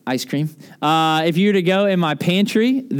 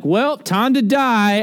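mock melodramatic; 5 semitones higher, much slower than usual. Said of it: "I'm like".

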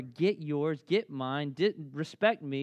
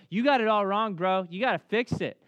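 blunt confrontation; 4 semitones higher, 7 dB louder than usual. Retold or imitated. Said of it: "David is humble and he's gentle".

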